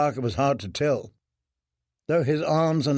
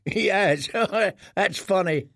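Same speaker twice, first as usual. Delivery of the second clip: put-on cruel boyish laugh through the words; 6 semitones higher than usual. Boy mocking the starved prisoner, laughing. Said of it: "The first boy laughed".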